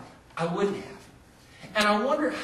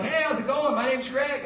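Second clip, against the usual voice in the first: cheery greeting; 6 semitones higher than usual. Retold or imitated. Greeting stranger in waiting room.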